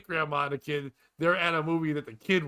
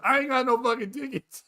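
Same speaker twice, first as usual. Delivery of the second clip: high, louder mock protest; indignant moviegoer; 7 semitones higher, 5 dB louder than usual. Woman asked for her ticket, mock indignant. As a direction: imitate a woman protesting loudly.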